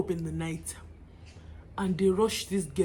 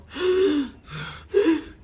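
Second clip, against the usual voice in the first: sharp panicked gasp, higher; gasp for breath; 6 semitones higher, 3 dB louder than usual. Retold or imitated. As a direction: catch a ragged breath in panic. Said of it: "started breathing hard".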